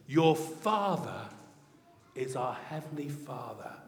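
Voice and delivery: ordinary delivery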